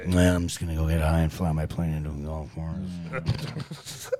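put-on low-pitched, subdued old grump; understated low grumble; Harrison Ford. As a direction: play it low and indifferent. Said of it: "subdued Harrison Ford".

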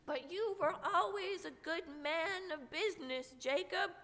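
put-on nervous high old man; faltering Scrooge. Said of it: "faltered Scrooge".

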